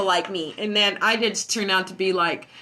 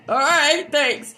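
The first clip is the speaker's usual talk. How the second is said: high clipped brush-off; 8 semitones higher, 6 dB louder, much slower than usual. Retold or imitated. Brushing off the neighbor.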